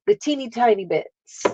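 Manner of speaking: ordinary talk, nothing marked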